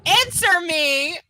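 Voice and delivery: loud, slow "Answer me"; accusatory demand. A narrator's reading. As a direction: demand a reply, drawing out "Answer me". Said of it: "becoming accusatory".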